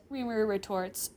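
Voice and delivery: ordinary delivery, nothing marked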